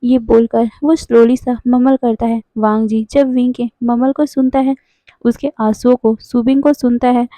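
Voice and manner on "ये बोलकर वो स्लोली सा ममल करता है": ordinary delivery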